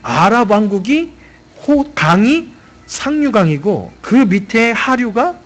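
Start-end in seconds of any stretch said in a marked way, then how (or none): none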